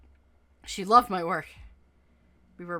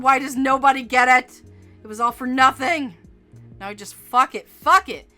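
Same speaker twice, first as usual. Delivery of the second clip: loud, high angry outburst; furious rant; 4 semitones higher, 9 dB louder than usual. Acted. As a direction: vent at full volume. Furious after failed interview.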